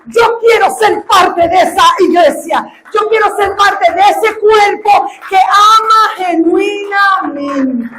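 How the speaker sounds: shouted tearful altar call; full-force preaching. Acted. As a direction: preach it out with fervour. Preaching climax.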